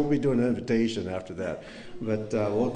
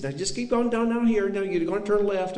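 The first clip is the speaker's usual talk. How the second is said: put-on Aunt Royce Tennessee accent; 12 semitones higher, 5 dB louder than usual. Nephew imitating his aunt inviting them, eager Tennessee accent. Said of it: "the strongest Tennessee accent".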